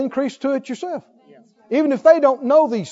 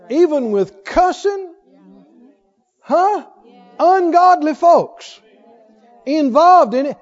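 higher, louder fervent preaching; 4 semitones higher, 4 dB louder than usual. Acted. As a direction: drive the point home to the congregation. Preaches at full force.

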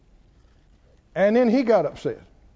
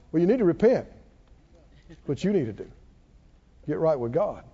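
low, soft, smug admonishing; acted-out quiet advice to repent; judging friends; 7 semitones lower, 4 dB quieter, faster than usual. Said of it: "And his three good friends sitting over there going".

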